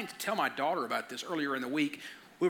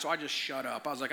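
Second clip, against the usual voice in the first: normal talking voice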